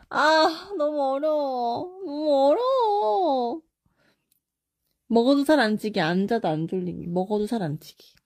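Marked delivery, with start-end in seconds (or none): 0.1-3.6 higher, half-joking whimper; fake-cry over an impossible choice; mock crying. Streamer stuck on a fan's choice, whining in mock tears.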